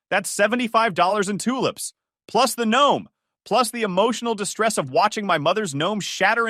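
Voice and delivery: high, loud itemized rant; spitting fury. Acted. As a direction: spit out every cost. Joyce tallies costs.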